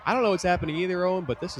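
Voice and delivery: high, loud and delighted; excited. Real, not acted. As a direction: toss it up high with glee. Amused at chaos.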